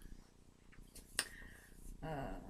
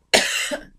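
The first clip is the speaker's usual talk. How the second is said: dry cough; a short real cough; 12 dB louder than usual. Real, not acted. Coughs, fears she will sneeze.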